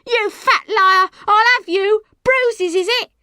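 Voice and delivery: high, loud accusing shriek; outraged street-row shouting; angry yelling. A narrator's reading. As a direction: hurl it at your accuser. Girl rages at woman before crowd.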